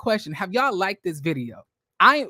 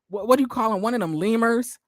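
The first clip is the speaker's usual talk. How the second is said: ordinary delivery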